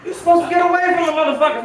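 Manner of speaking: laughing shout. Real, not acted. Comic rant, laughing shout.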